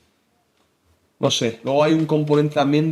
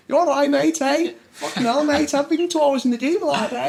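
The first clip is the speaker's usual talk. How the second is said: Scouse Liverpool accent, pitched much higher, laughing partway; 13 semitones higher than usual. Shown as a demonstration. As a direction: put on a thick Liverpool accent like a local lad. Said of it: "vendría a ser algo así como". (acento de Liverpool exagerado y mucho más agudo)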